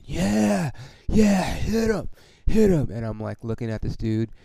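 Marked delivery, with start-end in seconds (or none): 0.0-2.9 high-pitched imitation of a jeering fan; heckler cheering. Fan cheering as a rival player gets hit, mock yelling. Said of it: "stands up and starts clapping".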